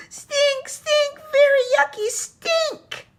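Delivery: very high, loud yell. A narrator's reading. Little brother yells to Mummy about the skunk, very high loud yell. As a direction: shriek it like a disgusted kid. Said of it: "yelled Tate".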